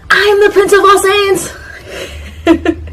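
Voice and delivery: Vegeta. Acted. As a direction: proclaim it like an over-the-top anime prince. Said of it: "this is with him saying".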